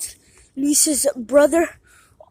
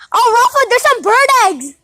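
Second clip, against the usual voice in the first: loud call across the yard; 6 semitones higher, 4 dB louder than usual. Real, not acted. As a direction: shout it out to Rafa. Calling out to brother.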